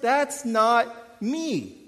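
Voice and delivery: slow, emphatic denial; slowly and deliberately reject the idea; slow, drawn-out insistence that it isn't me; firm refusal. Retold or imitated. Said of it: "I'm like".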